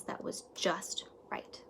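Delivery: hushed whisper of delight; whispered just right. A narrator's reading. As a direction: breathe it like a secret. Found the right book.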